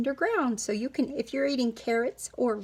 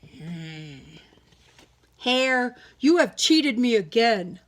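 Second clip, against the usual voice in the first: scowling bear; 4 dB louder, slower than usual. Acted. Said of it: "and scowled".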